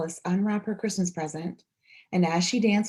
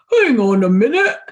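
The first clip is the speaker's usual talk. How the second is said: much louder, urgent hang on; loud cry; 5 semitones higher, 11 dB louder than usual. A narrator's reading. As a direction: call out to stop him. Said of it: "cried the man".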